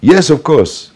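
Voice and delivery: high, loud eager agreement; eager yes. Retold or imitated. Soldier eagerly agreeing he loves [name], high loud yes. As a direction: answer at once with enthusiasm. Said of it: "They say".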